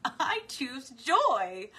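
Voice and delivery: laughing. Real, not acted. Teacher trying the affirmation while still laughing. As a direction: push the words out through laughing. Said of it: "can't even say it without laughing".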